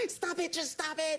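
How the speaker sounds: high, slow pleading yell; mock plea. Host imitating the guest scolding fans, mock pleading. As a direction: whine at the crowd to stop. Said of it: "Like you're standing there".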